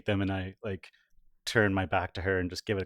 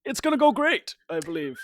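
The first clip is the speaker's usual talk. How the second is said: high, loud, eager reassurance; butter her up in a high, eager voice; fawning valet; 13 semitones higher, 6 dB louder than usual. Acted.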